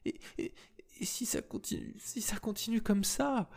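put-on breathy, halting whisper of a frightened girl; whispering through sobs. A narrator's reading. Esther, sobbing in the lift, asks what comes next. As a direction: choke the words out in tears. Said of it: "au milieu de ses sanglots".

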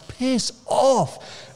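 high, sharp brush-off at the driver behind; mock snap. To Tony riding his bumper, a high mock brush-off. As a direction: tell the tailgater to go away. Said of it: "I'm just like".

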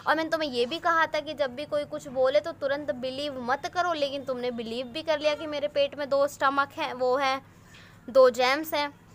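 normal talking voice

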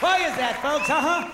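loud, high call-out to the audience; challenging the crowd. Real, not acted. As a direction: push the crowd for an answer, loudly.

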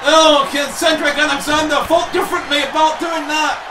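normal talking voice

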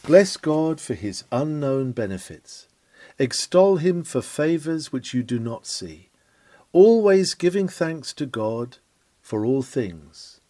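ordinary delivery

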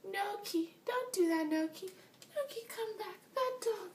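very high, mock scolding of a pet; imitated dog owner. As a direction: mimic an owner ineffectually calling the dog back in a piping voice. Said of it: "his master's all like".

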